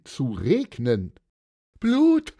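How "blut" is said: high, loud shriek of horror; scream. A narrator's reading. Wife seeing blood rain down, shrieking. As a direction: scream the word in panic. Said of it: "schrie Elisabeth von Reichberg".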